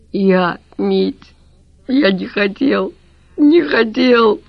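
tearful. A narrator's reading. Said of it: "Лавровский плакал".

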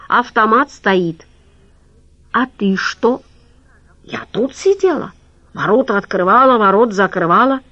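lightly voiced janitor with broken Russian; a hint of a nervous worker; migrant janitor voice.

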